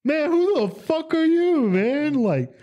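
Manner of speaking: squeaky high, whiny indignant caller; high mock angry caller. Scam caller complaining he's just doing his job, squeaky and high. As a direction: whine like the offended scammer defending his job.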